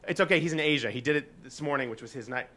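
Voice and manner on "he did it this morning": ordinary talk, nothing marked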